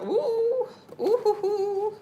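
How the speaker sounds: high, half-laughing, half-crying ooh sounds; startled ooh. Real, not acted. Eyes suddenly burning, reacting.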